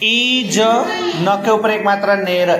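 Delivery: ईज and नेर drawn out as separate parts; spelled out slowly. Shown as a demonstration. Teacher spelling for pupils, ईज and नेर slowly stretched. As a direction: slowly build the word from ईज to नेर. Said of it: "पढ़ के".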